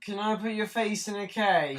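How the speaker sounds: high-pitched question sent across the house; calling out a request. Real, not acted. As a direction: ask it high, calling to a brother. Calls a request to brother.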